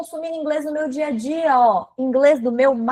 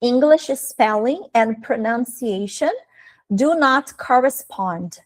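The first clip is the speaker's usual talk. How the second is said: very slow, phrase-by-phrase English; quoted teacher's line; much slower than usual. Retold or imitated. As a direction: quote the teacher slowly, pausing midway. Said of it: "Ela falou assim, ó".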